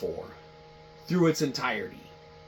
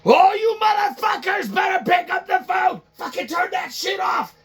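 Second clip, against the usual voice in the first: high, much louder angry yell; furious shout; 6 dB louder than usual. Retold or imitated. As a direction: bellow at the whole department. Boss yelling at staff.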